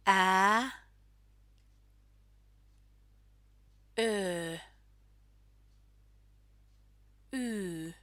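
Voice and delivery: a, o, u, ä, ö, y, i, e held one at a time; Finnish vowel drill. Shown as a demonstration. Teacher leading learners in saying the vowels together, each vowel drawn out. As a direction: sustain each Finnish vowel, leaving long gaps to repeat. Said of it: "Let's say the vowels together".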